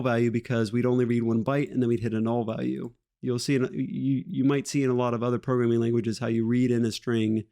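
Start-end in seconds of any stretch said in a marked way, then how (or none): none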